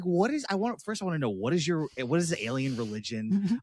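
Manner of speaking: high, puzzled questioning; incredulous. Retold or imitated. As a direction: ask it in high disbelief. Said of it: "I'm like".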